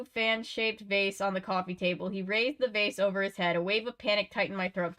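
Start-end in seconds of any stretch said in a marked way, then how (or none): none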